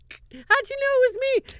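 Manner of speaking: mock mother's high squeal; high mom voice. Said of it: "And Mom's like".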